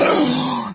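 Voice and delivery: hammed-up gasp of surprise; loud shocked gasp. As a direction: suck in air in mock shock. Mock amazed listener reacts.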